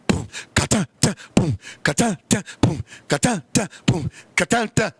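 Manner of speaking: beatbox the reggaeton rhythm low; mock reggaeton beat.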